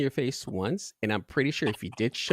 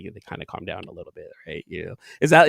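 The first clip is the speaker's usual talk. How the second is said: ordinary delivery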